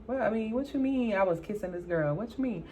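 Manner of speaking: ordinary delivery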